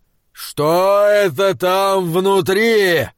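slow, puzzled giant voice; drawn-out wondering giant; dim giant. Acted. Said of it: "пробасил великан".

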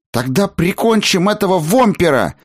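put-on high-pitched warlike boy; militant. A narrator's reading. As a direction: declare war in a high, eager voice. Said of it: "воинственно заявил Чаргас". (воинственный мальчишеский выкрик)

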